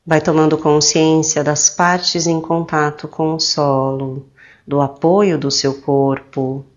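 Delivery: slow, soothing meditation voice with drawn-out phrase endings; calm, slow guidance with long pauses; guided meditation. Acted. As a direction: guide them gently into relaxation, unhurried.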